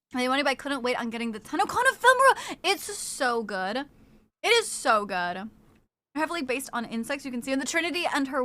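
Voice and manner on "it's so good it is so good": slowed right down, savoring each word. Real, not acted. Streamer admiring a cosmetic, slow savoring. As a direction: linger on it like a treat.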